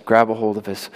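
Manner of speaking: ordinary talk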